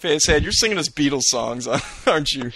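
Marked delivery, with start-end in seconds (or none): none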